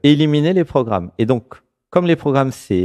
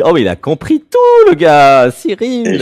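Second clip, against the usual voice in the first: much higher and louder, delighted; excited; 7 semitones higher, 8 dB louder, slower than usual. Real, not acted. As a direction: burst out in delight. Delighted the guest understood.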